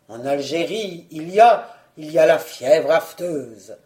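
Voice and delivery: low, halting answer; low, stalling husband; groping excuse. Acted. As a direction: fumble for a disease, low and hesitant.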